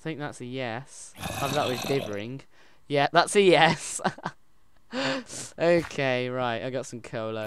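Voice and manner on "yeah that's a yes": loud, drawn-out, delighted yes; excited confirmation. Real, not acted. Player confirms the answer is a yes, excited. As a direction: belt it out slowly, higher and sure.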